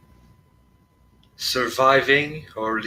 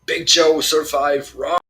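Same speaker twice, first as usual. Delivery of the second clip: loud, raised shout to comrades; calling out; 5 semitones higher, 9 dB louder, slower than usual. Acted. Player calling to Big Joe and Roy, shouting. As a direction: call it out at the top of the game. Player calls out to game characters.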